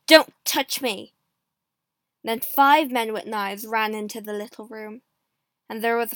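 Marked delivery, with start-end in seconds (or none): 0.1-1.2 loud, forceful order; angry warning. A narrator's reading. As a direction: bark it out to drive them away. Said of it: "he said angrily".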